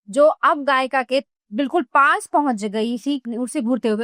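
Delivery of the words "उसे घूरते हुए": ordinary delivery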